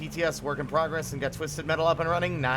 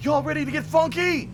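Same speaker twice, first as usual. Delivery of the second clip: hype call; 9 semitones higher, 5 dB louder than usual. Acted.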